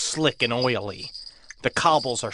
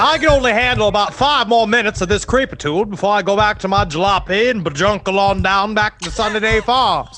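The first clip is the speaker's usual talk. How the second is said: milkman voice; 5 semitones higher, 5 dB louder than usual. Acted. Milkman fleeing the creepy prairie, fast and loud. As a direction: rattle it off in a rush.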